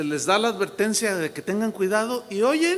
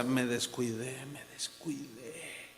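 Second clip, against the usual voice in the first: retold weeping confession; 5 semitones lower, 11 dB quieter than usual. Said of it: "él lloraba y decía".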